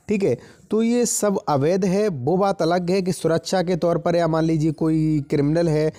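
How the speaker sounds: normal talking voice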